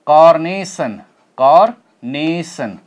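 "carnation" drawn out very slowly, second syllable stressed; slow model word. Shown as a demonstration. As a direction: say "carnation" slowly and clearly, teacher style. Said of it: "Similarly we have".

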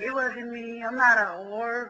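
the cat pleads innocence at night, high and slow; pleading cat. Retold or imitated. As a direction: beg forgiveness as the cat.

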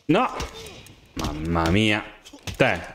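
ordinary delivery

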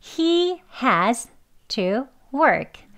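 slow, careful read of He has to work; slow model sentence. Shown as a demonstration. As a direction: model the sentence slowly for learners. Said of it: "has라고 발음하는 경우".

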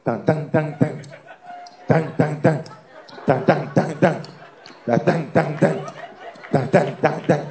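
even, percussive drum beats by mouth; imitated drums. Man starts hearing cannibal drums, imitated drumbeat. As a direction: beat out short repeated drum hits. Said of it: "empezó a sentir los tambores".

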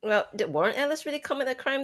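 ordinary talk, nothing marked

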